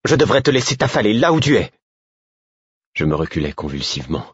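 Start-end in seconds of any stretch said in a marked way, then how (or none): 0.1-1.7 high, indignant scolding; furious. A narrator's reading. Girl scolding the friend she holds up, furious. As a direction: scold them, voice pitched way up. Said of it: "s'exclama-t-elle avec fureur".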